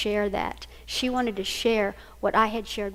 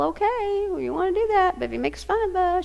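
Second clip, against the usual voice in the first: reluctant agreement; 9 semitones higher than usual. Retold or imitated. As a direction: give in doubtfully, pitch lifted high. Mother reluctantly gives in to daughter.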